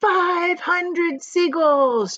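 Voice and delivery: slow, loud, high proclamation; shouted. Acted. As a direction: announce the count triumphantly. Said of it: "James shouted".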